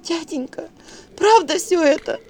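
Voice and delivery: sobbed. A narrator's reading. Bride confesses to her father, whimpering high. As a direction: plead through tears. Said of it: "всхлипнула девка".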